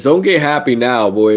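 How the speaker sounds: ordinary talk, nothing marked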